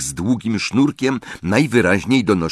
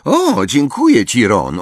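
Hermione's voice; 5 semitones higher, 4 dB louder than usual. A narrator's reading. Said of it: "odpowiedziała trochę zmieszana, ale wyraźnie ucieszona".

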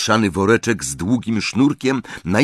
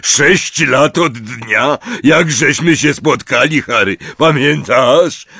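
higher, louder and slow big-man voice; a big friendly giant reminiscing; Hagrid's voice; 7 semitones higher, 5 dB louder than usual. A narrator's reading. Hagrid to Harry, fondly remembering.

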